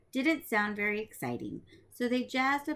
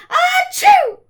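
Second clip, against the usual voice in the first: huge, much higher and louder fake sneeze; 19 dB louder than usual. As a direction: blast out an exploding achoo. The wolf sneezes at the door.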